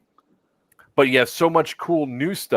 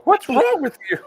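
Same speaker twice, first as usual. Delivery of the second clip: shrill mother voice. Retold or imitated. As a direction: shout it like an annoyed mom. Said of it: "coming out of her window".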